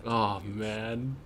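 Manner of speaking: usual speaking voice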